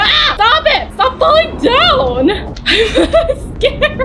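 high-pitched panicked squeal with laughter; frightened, laughing shriek; scared. Real, not acted. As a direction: plead in a high, giggly panic.

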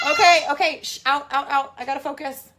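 real flustered parent shushing. Real, not acted. Shooing noisy kids out mid-video.

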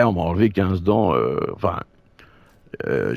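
ordinary talk, nothing marked

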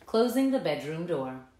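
plain reading voice

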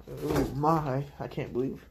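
ordinary delivery

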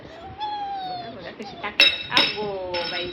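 high, loud slowed exclamation; loud high amazement; mock surprise. Real, not acted. Baby throws bottle again.

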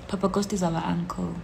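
ordinary talk

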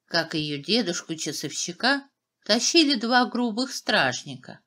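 ordinary delivery

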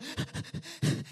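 rapid voiceless sobbing puffs; imitated hyperventilating. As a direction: pant like someone crying too hard. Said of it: "he's hyperventilating".

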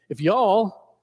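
light attempt at a Southern drawl. Shown as a demonstration. Preacher trying out Southern for the congregation, light drawl. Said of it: "we can say that in the Southern drawl".

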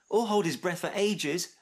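high, light interjection; helpful addition. A narrator's reading. Max adding to his friend's list, helpful chipping in. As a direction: chip in at normal volume. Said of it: "added Max".

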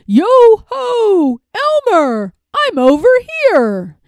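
high, loud singsong yoo-hoo call. A narrator's reading. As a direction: call out playfully to someone searching for you. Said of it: "rushed to where the voice came from".

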